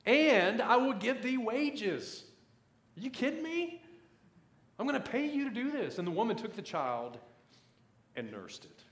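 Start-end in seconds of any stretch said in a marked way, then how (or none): 0.0-2.2 high, loud, drawn-out proclamation; amazed emphasis. Acted. Preacher reveals mother will be paid, amazed drawn-out proclamation. Preacher relishes the astonishing verse. 3.0-6.0 very high-pitched incredulous outburst; mock disbelief. Preacher reacts to mother being paid to nurse, high-pitched mock disbelief. Preacher acts out astonishment at wages.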